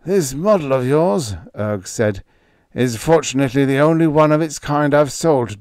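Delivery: a hint of a shaken scientist; lightly voiced scientist. A narrator's reading. Erg, frightened scientist.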